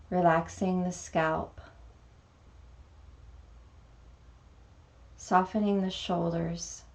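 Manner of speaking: calm meditation guide, long pauses between phrases; guided meditation. Acted. Teacher guiding beginners through a mini meditation, calm meditation voice. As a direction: lead gently into stillness, unhurried, letting each phrase settle.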